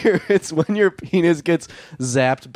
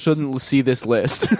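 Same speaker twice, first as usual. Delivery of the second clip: normal talking voice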